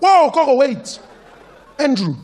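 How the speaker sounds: high, loud cry to stop her; urgent call. Retold or imitated. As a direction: shout to stop the door closing. Child stops grandma.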